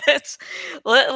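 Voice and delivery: plain reading voice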